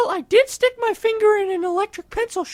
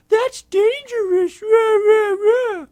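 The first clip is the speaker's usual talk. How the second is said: high, loud mocking nagging; mocking nag voice; 5 semitones higher, 8 dB louder, slower than usual. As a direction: parrot a nagging parent, mockingly. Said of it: "you're all like".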